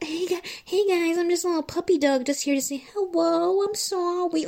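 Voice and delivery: high sheepish puppy voice; mock little puppy dog voice; cartoon dog. Mocking Schwartz as puppy dog.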